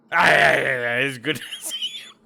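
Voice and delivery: loud, laughing kobold shout; game master voicing the new kobold V-Flip, shouting happily. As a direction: bellow the greeting with a laugh. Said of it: "brand new kobold ... named V-Flip".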